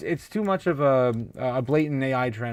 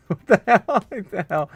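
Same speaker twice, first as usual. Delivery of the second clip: laughing disbelief; 5 semitones higher, 5 dB louder than usual. Real, not acted. Laughing reaction to chat.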